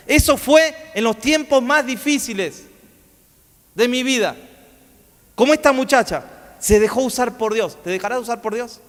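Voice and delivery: exhortación en tono más agudo; prédica enérgica. Real, not acted. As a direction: interpela a la congregación con fuerza. Predica y exhorta al público. (predicación exhortativa, elevada)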